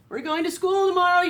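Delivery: high, loud eager farmer; thrilled news shouted to the cows; excited announcement. Acted. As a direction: call it out to the cows with glee. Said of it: "The cows had never been to school".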